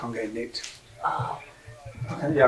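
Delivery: ordinary talk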